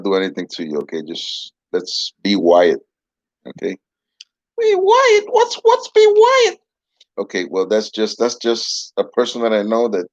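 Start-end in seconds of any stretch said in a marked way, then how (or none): 4.6-6.6 higher, louder, slow puzzled puppet; a baffled dummy asking. Acted. Puppet confused by phrase.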